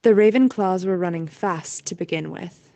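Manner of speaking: ordinary talk, nothing marked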